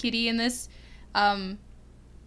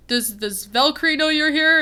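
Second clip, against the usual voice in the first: higher, louder put-on hero voice; Thor; 5 semitones higher, 7 dB louder than usual. Fan voicing Thor questioning his houseguest Loki, loud and pitched up. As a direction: ask it projected and pitched up, in character. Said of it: "And then he's like".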